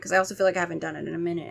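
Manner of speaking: ordinary talk, nothing marked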